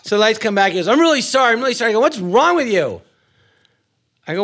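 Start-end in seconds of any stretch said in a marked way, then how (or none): 0.9-1.9 high, insistent mock apology; pleading apology. Sketch partner apologising when the lights come back, high pleading. 2.0-3.0 high, drawn-out indignant question; mock outrage. Blind man confronting his bed partner, mock outrage. Retold quarrel in the sketch.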